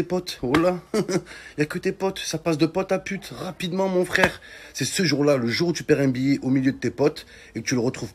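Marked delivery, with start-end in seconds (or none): none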